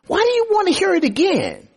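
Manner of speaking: high, fast, exasperated outburst; healed blind man, fed up with the Pharisees, snapping high and quick; frustrated. Acted. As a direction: throw the question back at them, fed up and pitched high. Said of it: "this guy is very frustrated".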